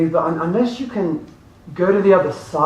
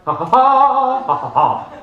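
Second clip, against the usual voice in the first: forced laughter while running; 7 semitones higher, slower than usual. Shown as a demonstration. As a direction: laugh on purpose while jogging. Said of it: "you're like".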